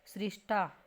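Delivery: usual speaking voice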